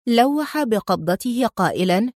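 plain reading voice

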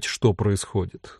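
ordinary delivery, nothing marked

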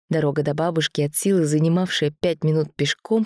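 ordinary delivery, nothing marked

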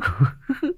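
high, soft feminine giggle; little high laugh, kept soft; coy giggle. Acted. Woman responding to her lover's promise, giggling coyly.

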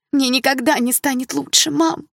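usual speaking voice

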